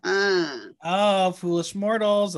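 slow spooky ghost host voice; slow theatrical villain menace. Pretend. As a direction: draw it out like a haunted mansion host. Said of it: "Madame Leota".

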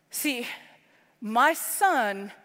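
usual speaking voice

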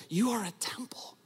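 soft unvoiced whisper. Real, not acted. Preacher tenderly telling listeners they are temples, whispered. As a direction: let it land in a hush.

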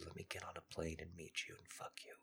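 breathy whisper, no voice. Real, not acted. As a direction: whisper it close and secret. Intimate sexual proposition, hushed.

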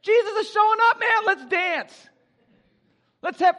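excited party call; party invitation. Retold or imitated. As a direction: hype up the party. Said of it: "It's like".

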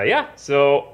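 ordinary talk

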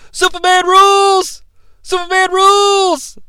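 high, drawn-out mock yell; Beetlejuice's random yell. Wrestling guest yelling into a microphone, high mock cheer. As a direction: imitate a random cheer, held on one high pitch. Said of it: "he randomly yells".

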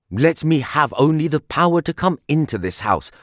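plain reading voice

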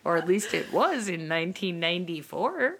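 put-on playful Minnesotan accent; lightly put-on Minnesota voice. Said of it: "working on my Minnesotan accent".

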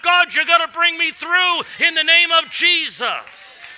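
loud, high fired-up sermon voice; full-force preaching. Acted.

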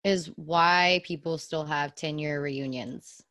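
usual speaking voice

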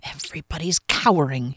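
understated inner grumble; annoyed thought. A narrator's reading. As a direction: think it to yourself with mild annoyance. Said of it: "he thought with annoyance".

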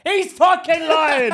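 put-on shouted accusation in English accent. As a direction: yell it high and drawn-out, English accent. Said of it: "slip into my English accent and be like".

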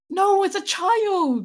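mock horrified. Shocked reply.

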